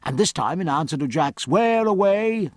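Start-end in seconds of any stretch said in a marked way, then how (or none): 1.5-2.5 high, slow, drawn-out hail; captain's long-carrying question; calling aloft. A narrator's reading. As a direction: call up to the masthead. Captain hails the masthead lookout.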